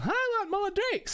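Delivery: hammed-up high girly whine; tipsy girl. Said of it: "the girl is kinda like".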